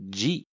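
G said as "ji", like English "jee"; Brazilian de sound. Shown as a demonstration. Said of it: "de还有另一种发音就是". (巴普特有的发音，G读作“ji”)

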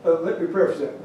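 ordinary delivery

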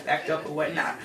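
normal talking voice

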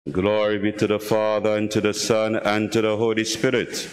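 normal talking voice

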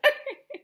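high mischievous cackle. Acted. Peeves delighted at catching children out, high cackle. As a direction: cackle like a gleeful poltergeist. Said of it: "Peeves cackled".

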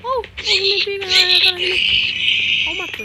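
high, loud panicked yell; panicked shout. Real, not acted. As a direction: yell as it grabs you. Mob attacks him in game. (grito de pânico)

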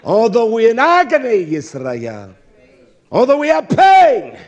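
high, loud, impassioned sermon cry; fiery preaching. Acted. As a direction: preach it out to the congregation. Preaching at full force.

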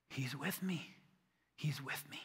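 soft whisper, repeated to yourself; whispered affirmation. Shown as a demonstration. Preacher modeling a personal prayer, whispered. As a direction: whisper it as a private comfort. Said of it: "put it in a different way to say it".

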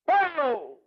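sudden booming crash, loud and high; imitated boom. Preacher recalling the doll exploding, loud mock boom. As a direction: burst out the crash like a shotgun. Car crushes doll head.

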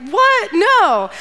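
high-pitched mock indignant outburst; scornful refusal. Nabal turning down David's men, mock indignant. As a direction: blurt an acted, incredulous refusal.

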